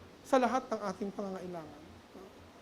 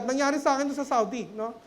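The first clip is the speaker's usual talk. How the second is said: normal talking voice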